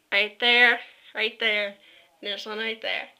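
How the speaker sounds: ordinary delivery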